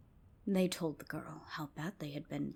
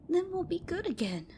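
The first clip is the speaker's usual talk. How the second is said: put-on higher, louder childish scoff; scoffing girls; 12 semitones higher, 4 dB louder than usual. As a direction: scoff like naughty kids. Girls scheming.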